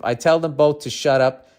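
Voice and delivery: ordinary talk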